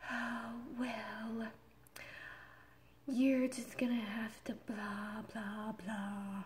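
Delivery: breathy, whispery imitation of an exhausted agent; tired, drugged-out drawl. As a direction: drag it out like you could pass out any moment. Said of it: "talking like she's so tired".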